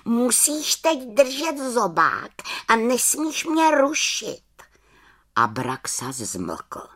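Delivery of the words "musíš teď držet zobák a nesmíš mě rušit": high little witch laying down conditions; high-pitched, bossy instructions to a companion; small witch voice, pitched high. Acted. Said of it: "řekla malá čarodějnice".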